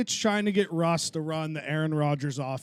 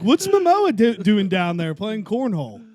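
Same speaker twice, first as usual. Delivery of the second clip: high, loud burst of mock bewilderment; incredulous, half-laughing yelp; baffled double take; 4 semitones higher, 7 dB louder than usual. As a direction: retell the astonished question, starting high. Acting out his confused triple take.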